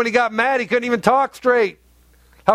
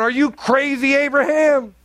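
hint of an exasperated wife; 6 semitones higher than usual. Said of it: "Sarah going".

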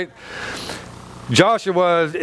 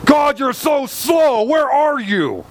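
mock impatient shout; 8 semitones higher, slower than usual. Impatient believer yelling at God, a hammed-up whine. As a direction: drag it out like a spoiled complainer.

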